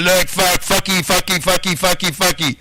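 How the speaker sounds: high, loud mocking sing-song of fucky, fucky. Mocking someone he argues with, high and loud.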